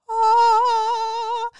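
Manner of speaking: high, loud delighted shriek; excited squeal. Real, not acted. Excited squeal about stuffed French toast.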